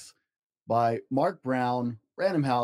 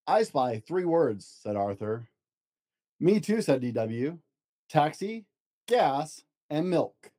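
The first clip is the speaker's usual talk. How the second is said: storybook narration, gentle and lively; reading to children; 3 semitones higher than usual. Acted. Dad reads picture book to child, storybook voice. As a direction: read it aloud to a child at bedtime.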